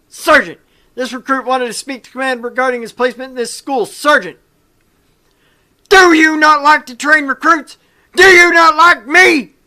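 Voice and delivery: whiny pleading recruit. Acted. Recruit pleading with the sergeant about placement, hammed-up whine. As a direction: beg the sergeant like a wounded kid.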